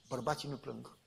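a low soft mock-proud denial; proud quiet denial. Proud denial while crying. (negare mândră, mult mai încet)

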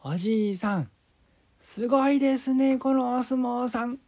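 high, loud excited granny; old woman voice. Acted. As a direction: gush like an amazed old granny. Grandma voiced in story.

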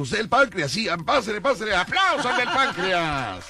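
high calling-out host; hyped fairground barker. Retold or imitated. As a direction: shout to the crowd, high.

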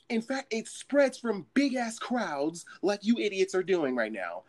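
fake-sweet crowd announcement; polite announcement. Worker addressing the crowd, sugary polite but stern. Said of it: "I say it as politely as possible".

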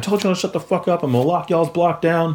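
high-pitched, quick mock yell; retold yelling. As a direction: re-enact scolding the inmates without full volume. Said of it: "I yelled at the inmates".

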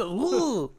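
high loud woo like a fighter's cry; mock game shout. Joking about the game character's cry, a high loud woo. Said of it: "It says".

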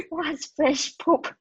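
laughing, slow disbelieving question. Real, not acted. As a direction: ask it through a laugh.

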